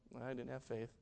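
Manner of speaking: very soft, low admission; discouraged thought. Retold or imitated. Imagining what sticks in Peter's mind, discouraged. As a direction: say it under your voice, let down.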